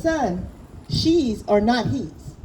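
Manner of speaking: usual speaking voice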